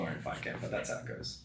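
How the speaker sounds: trailing off into a blurred mumble; mumbled. Real, not acted. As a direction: mumble it, fading to almost nothing. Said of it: "What's that, sorry?".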